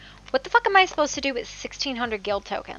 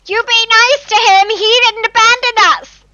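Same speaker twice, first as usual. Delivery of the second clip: much higher, loud scolding shout; angry high shout; 13 semitones higher, 15 dB louder than usual. Acted. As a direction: scold them shrilly in defence. Acted quarrel with friend's character.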